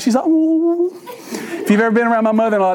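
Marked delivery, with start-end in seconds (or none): 0.2-1.0 high, drawn-out mock "ooh" of weeping; mock crying wail. Mother-in-law cries over the box, mock wail. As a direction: imitate a mother-in-law's tearful wail. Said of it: "Miss Carla's crying. She's like".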